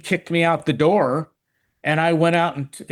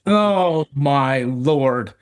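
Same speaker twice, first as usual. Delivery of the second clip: normal talking voice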